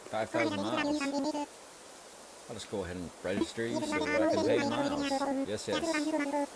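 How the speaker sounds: folksy shopkeeper with a southern lilt, pitch jumping up on the welcome; Tom Nook voice. Acted. Streamer voicing Tom Nook welcoming the player after a nap, southern lilt. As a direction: greet the sleepy islander like a cheerful southern landlord. Reading Tom Nook's dialogue.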